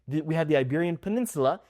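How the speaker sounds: ordinary delivery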